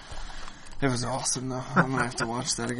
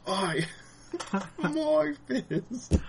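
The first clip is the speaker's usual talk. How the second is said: can barely get the yell out for giggling; laughing mock scream; 10 semitones higher, 5 dB quieter, much slower than usual. Retelling the cartoon fight to his cohost, high laughing mock yell. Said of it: "and he screams".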